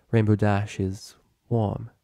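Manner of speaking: ordinary delivery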